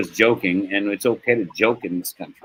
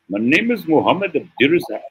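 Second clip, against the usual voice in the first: higher-pitched imitation of a friend's foreign accent; Muhammad's accent; 6 semitones higher than usual. Co-worker introducing himself on first meeting, in an imitated Somali accent. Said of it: "they have a crazy accent".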